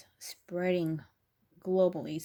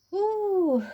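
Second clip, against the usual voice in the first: high, loud "ooh" sliding down; sudden high exclamation; 13 semitones higher, 8 dB louder than usual. Real, not acted. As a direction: let out a big drawn-out whoop of an ooh. Spontaneous exclamation between card readings.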